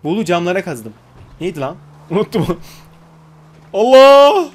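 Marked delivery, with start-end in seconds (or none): 3.7-4.5 loud high laughing cry; loud laughing Allah; laughing shout. Real, not acted. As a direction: burst out laughing in shock.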